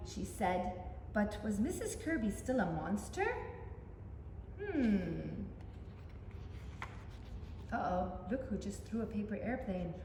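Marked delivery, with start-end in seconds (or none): none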